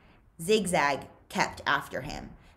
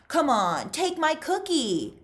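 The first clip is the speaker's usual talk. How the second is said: higher, louder needling taunt; pushy taunting; 11 semitones higher, 4 dB louder than usual. Acted. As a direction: needle him with it. Zigzag insisting.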